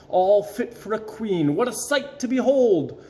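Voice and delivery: plain reading voice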